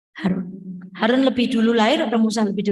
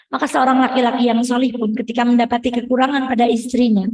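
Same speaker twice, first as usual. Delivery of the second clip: normal talking voice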